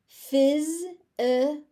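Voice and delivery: "Physical" slow and loud, a buzzing Z in the middle; Z sound in physical. Shown as a demonstration. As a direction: stretch "Physical" so the Z buzz is heard. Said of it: "the S becomes a Z sound".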